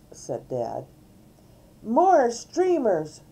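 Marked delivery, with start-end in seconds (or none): 1.8-3.2 loud, high, drawn-out demand. A narrator's reading. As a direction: demand it like a bossy child. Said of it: "D W demanded".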